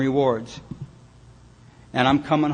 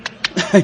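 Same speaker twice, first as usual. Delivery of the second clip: short, loud imitated laugh; a quick laugh to mimic the sound he heard; hammed-up chuckle from the garage; mock chuckle; 5 semitones higher, 5 dB louder than usual. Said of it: "And I hear this".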